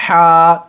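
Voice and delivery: ہا loud and breathy from mid throat; letter sound demo. Shown as a demonstration. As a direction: push ہا out from the middle of the throat. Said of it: "یہاں سے آپ کو ہا کو نکلنا". (حلق کے درمیان سے زور سے ہا)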